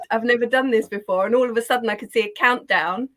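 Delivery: normal talking voice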